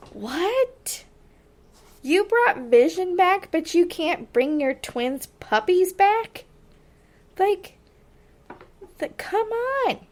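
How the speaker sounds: exasperated viewer calling out the plot hole; incredulous outburst. Retold or imitated. Fan mocking the show's excuse, incredulous and high.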